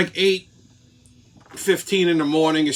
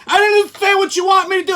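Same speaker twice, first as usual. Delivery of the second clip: high, fast, whiny mock girl; mocking clueless girl; 12 semitones higher, 4 dB louder, faster than usual. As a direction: rattle it off way up high, playing dumb. Girl answers him, mimicked.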